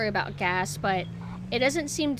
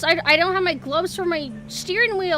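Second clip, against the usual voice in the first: whiny cold; 7 semitones higher, 5 dB louder than usual. Pretend. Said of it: "I'm cold".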